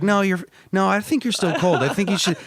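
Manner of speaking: mocking imitation of a fake nice guy; mock fake-polite guy.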